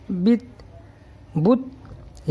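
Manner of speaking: بت read as bit, clearly twice; sounding out bit. Shown as a demonstration. Teacher shows how بت sounds with zer. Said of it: "تو اس طرح کریں گے بت".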